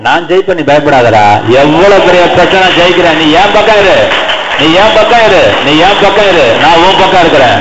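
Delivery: loud, driving exhortation; full-force preaching. Real, not acted. Preacher assuring congregation of victory, loud full-force exhortation. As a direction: fire up the congregation with punched repeats.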